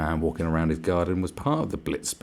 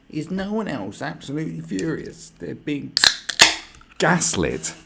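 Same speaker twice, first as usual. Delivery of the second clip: high-pitched mock indignation; higher, hammed-up fury; mock outrage; 10 semitones higher, 8 dB louder than usual. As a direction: read it out like someone absurdly furious. Reads out an outraged post mockingly.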